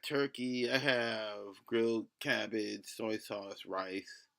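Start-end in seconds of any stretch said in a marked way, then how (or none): none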